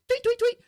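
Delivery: high chirped tweet tweet tweet; imitated tweets. Knife thrower showing the flute sound, high chirps. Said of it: "Do that flute thing".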